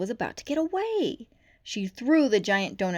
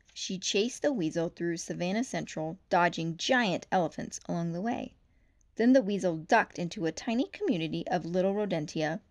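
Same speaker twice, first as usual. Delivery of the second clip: ordinary delivery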